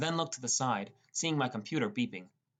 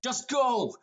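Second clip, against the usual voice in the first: much higher, louder push; desperate shout; 13 semitones higher, 5 dB louder than usual. Acted. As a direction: shout her away. Forcing her to leave.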